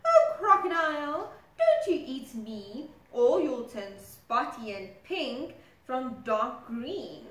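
teasing zebra. Acted. Zebra warns crocodile.

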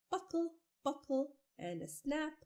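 plain reading voice

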